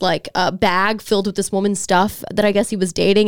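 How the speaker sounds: usual speaking voice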